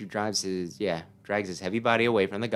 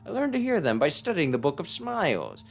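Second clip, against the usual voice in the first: put-on eccentric old sage; 6 semitones higher than usual. Player voicing the strange old mushroom sage for the boy. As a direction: coo it like a batty hermit who hears mushrooms.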